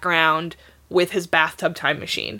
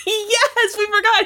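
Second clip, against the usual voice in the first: laughing; 13 semitones higher than usual. Real, not acted. Laughing at forgotten item.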